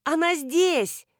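high, drawn-out call of reply; calling back. A narrator's reading. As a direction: call back as if across a yard. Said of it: "Nina calls back across yard".

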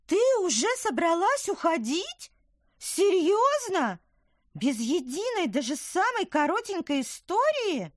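high, bright little woodland creature; chirpy tiny creature, earnest; little apple creature. Acted. Tykver protests.

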